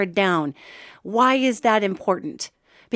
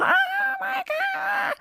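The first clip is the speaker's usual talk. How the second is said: long, much higher panicked wail; cartoon tuna shrieking in terror, drawn out; a stretched-out high panicked cry from a trapped fish; 6 dB quieter, much slower than usual.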